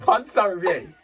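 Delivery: giggling through the words, loud; fighting back laughter as you read; reading a review out between giggles; laughing while reading. Real, not acted.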